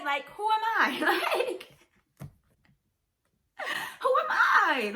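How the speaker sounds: high mock-dramatic question. Real, not acted. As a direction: ask it high, half joking. Repeat, softer dramatic.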